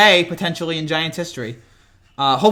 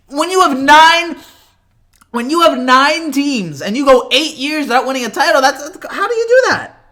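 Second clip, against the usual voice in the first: incredulous rant; 8 semitones higher, 5 dB louder than usual. Real, not acted. Incredulous rant about nine teams.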